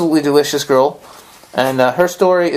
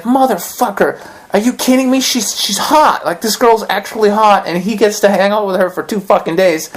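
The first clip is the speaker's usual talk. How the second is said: higher, indignant jealous griping; retold fury, half laughing at himself; jealous rant; 6 semitones higher than usual. Watching his instructor teach the cute girl, jealous and higher. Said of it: "I was angry".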